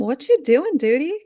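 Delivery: duty drawn up high and loud as a pun; stress on duty. Shown as a demonstration. Podcaster punning on a show name, stress on duty. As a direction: lean hard on duty with a rising lilt. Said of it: "Emphasis on duty".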